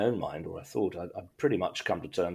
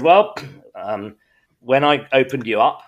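higher-pitched, lightly put-on voice of the surgeon; voiced consultant; 7 semitones higher, 6 dB louder than usual. Surgeon telling the patient it went better than expected, lightly voiced and higher. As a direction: play the consultant breaking good news, voice raised a little.

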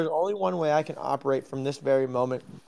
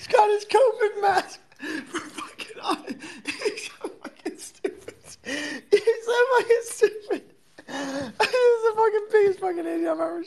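laughing. Real, not acted. Laughing at sleeping friend.

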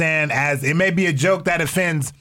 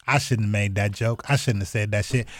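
mock apology; 9 semitones lower, 5 dB quieter than usual. Mocking a forced apology.

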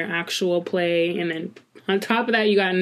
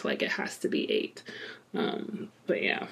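whispered aside, much softer; whispering; 11 dB quieter than usual. Real, not acted. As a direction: drop to a whisper.

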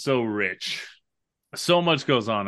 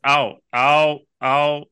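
mock pain; 3 semitones higher, 5 dB louder, much slower than usual. Acting hurt by tiny bullets.

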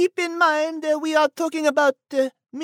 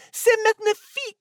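exaggerated French accent, higher; french chef; 6 semitones higher, faster than usual. French character exclaims.

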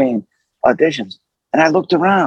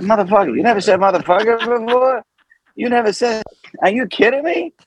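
high-pitched mock disbelief; incredulous; 9 semitones higher than usual. Streetwise partner can't believe he never swore, incredulous. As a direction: react in raised, incredulous amazement.

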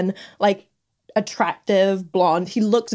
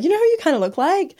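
quick, higher-pitched quote of a friend; lightly mimicked leading question; retold teasing question; 9 semitones higher, faster than usual. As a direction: toss out a cheeky question, pitched up and brisk. Retelling friend's teasing question to Alan.

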